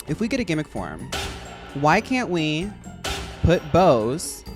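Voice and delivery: ordinary delivery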